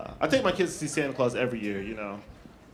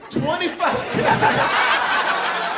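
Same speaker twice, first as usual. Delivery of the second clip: disbelief; 12 semitones higher, 6 dB louder, much slower than usual. Retold or imitated. Shocked at the birth price.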